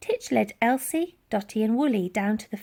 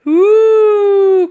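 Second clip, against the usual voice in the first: high, loud mammoth hoot; frightened mammoth call; animal cry; 11 semitones higher, 15 dB louder than usual. A narrator's reading. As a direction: trumpet like a beast in panic. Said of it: "cried the animal".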